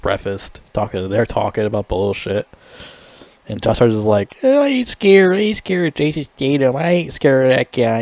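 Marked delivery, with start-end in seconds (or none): 4.4-8.0 high mock whine that fades down; lightly mocking whiny tough-guy bluff; whiny coward voice. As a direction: sneer it like a scared little kid pretending he's brave. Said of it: "Like a little bitch".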